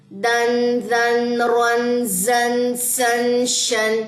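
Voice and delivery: long, even chanted syllables; very slow phonic reading. Shown as a demonstration. Teacher reads the phonics line to students, very slow chant. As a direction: stretch each syllable for the class. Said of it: "phonic reading".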